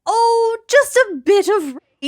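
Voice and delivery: high incredulous mocking voice; mock disbelief. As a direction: squeal it in disgusted disbelief.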